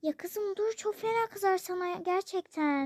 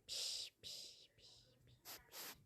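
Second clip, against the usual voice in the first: soft whispered "piş piş"; whispered calling; 25 dB quieter, much slower than usual. Acted. Whispering to call the cat. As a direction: coax a cat softly.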